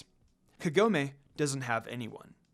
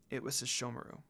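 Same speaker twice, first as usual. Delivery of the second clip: sad confession; 6 semitones lower, 11 dB quieter, faster than usual. A narrator's reading. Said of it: "looked down sadly".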